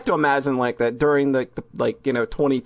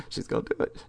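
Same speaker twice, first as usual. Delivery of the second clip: whisper; 7 semitones lower, 10 dB quieter, faster than usual. Acted. Whispered repeat.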